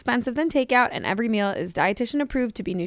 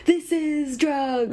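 mock teacher voice; 6 semitones higher, much slower than usual. Mocking health class teacher.

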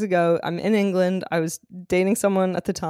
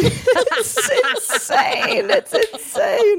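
high, drawn-out words through laughter; real laughing fit while talking; helpless laughter; 13 semitones higher, much slower than usual. Real, not acted. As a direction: laugh so hard the words stretch and squeal. Cracking up at the played clip.

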